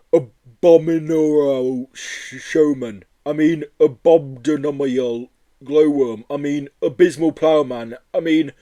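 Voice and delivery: slow, loud, sad creature voice; a mournful monster correcting him; sad Yeti. Acted. Yeti at the window to Joe, slow and sad.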